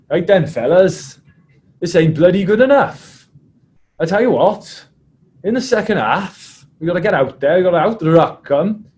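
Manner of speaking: loud, high impression of a Welsh prop; Adam Jones. Prop rallying his team at half time, loud mimicked rant. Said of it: "it went something like this".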